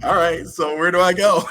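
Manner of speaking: high, loud, half-laughing eager question; excited retold question. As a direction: blurt it out, caught off guard and amused. Retelling his frantic arrival.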